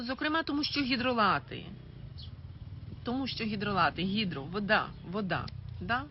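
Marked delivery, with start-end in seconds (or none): none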